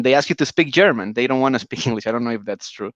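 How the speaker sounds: ordinary delivery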